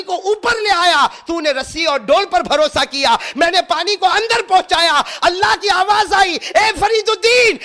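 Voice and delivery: high, loud booming divine call; voice of God. Acted. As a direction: proclaim like a voice from the unseen. Voice of God answering.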